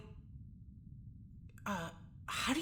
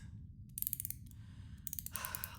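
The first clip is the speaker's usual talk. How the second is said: long weary sigh; 15 dB quieter than usual. Acted. Jules tired and fed up.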